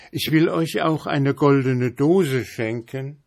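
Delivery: high, desperate begging; landlady begging and offering a gift; pleading. A narrator's reading. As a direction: plead and bargain for life. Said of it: "rief flehend die Wirtin".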